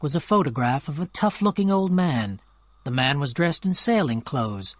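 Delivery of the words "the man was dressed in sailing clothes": ordinary delivery, nothing marked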